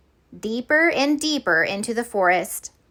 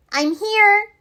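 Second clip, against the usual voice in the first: put-on breathless high girl; 11 semitones higher than usual. Betty arriving late.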